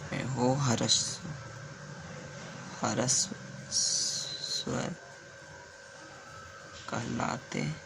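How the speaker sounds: soft, very slow murmur under the breath; half to himself as he writes on the board; muttered words while writing slowly; muttering while writing. Real, not acted. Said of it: "लिख ही देता हूँ".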